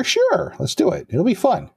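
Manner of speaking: mock-eager squeak up high; high chirpy cheer. Maria agreeing to the sewer trip, chirpy and high. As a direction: bounce up high, game for anything.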